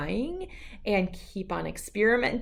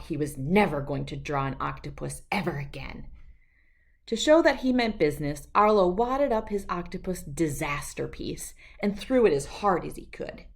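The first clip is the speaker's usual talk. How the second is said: plain reading voice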